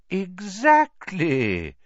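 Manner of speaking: drawn-out, bright and pleased; beaming. A narrator's reading. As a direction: savour the word with pride. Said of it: "beaming once more".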